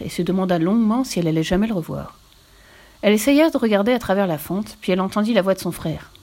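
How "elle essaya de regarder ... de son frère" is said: ordinary delivery, nothing marked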